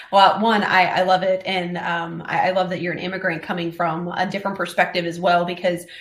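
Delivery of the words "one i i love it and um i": normal talking voice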